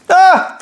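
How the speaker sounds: high, loud cry of astonishment; sudden "ah!" yelled high; delighted scream. Real, not acted. Unboxer finding a double set of boosters, excited scream. Discovers two full sets of four.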